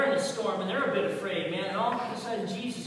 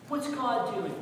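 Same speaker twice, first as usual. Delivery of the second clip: ordinary delivery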